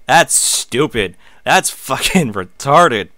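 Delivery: slow, loud, drawn-out contempt; real disdain, loud and deliberately slow; scornful put-down. Real, not acted. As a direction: lay into it slowly and loudly, sneering. Mocking the show's plot.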